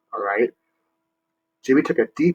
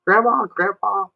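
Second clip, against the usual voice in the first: put-on higher, fast eager child question; asking grandparents; 8 semitones higher, faster than usual. Shown as a demonstration. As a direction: ask grandma excitedly.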